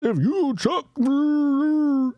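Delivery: high, drawn-out mock Midwest accent. Host mocks how senator talks, mocking Midwest accent.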